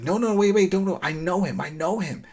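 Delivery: high, hurried mock protest; urgent plea. Lydia stopping Aaron from attacking, urgent plea. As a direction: imitate someone urgently calling a halt. Acting out a character halting an attack.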